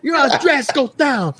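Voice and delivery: high, slurred "Your odds drastically go down" run together as "drasko"; mock mispronounced line. Friend mimicking a wrestler's garbled promo, high and slurred. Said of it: "it sounds like he's saying".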